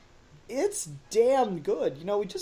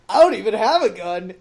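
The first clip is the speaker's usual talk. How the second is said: louder quoted thug line; mock protest of a hapless henchman; imitating incompetent thug; 3 semitones higher, 10 dB louder than usual. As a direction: blurt it out like a clueless goon. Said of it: "hear this guy be like".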